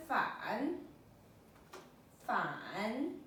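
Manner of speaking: "fan" drawn out, dipping then rising; third tone on fan. Shown as a demonstration. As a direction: let "fan" sink and lift at the end. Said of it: "Third tone".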